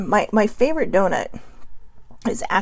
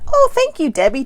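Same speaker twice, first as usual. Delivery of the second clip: much higher, louder, happy gasp of thanks; delighted surprise; 7 semitones higher, 5 dB louder than usual. Real, not acted. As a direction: caught off guard by a kind comment. Reads a viewer's compliment live.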